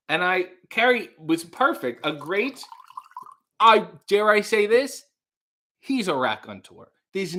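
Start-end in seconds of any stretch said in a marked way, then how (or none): none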